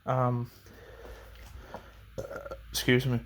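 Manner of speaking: breathless, catching breath between words; pausing to get air back. Real, not acted. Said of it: "Sorry, I'm catching my breath".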